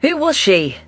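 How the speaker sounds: plain reading voice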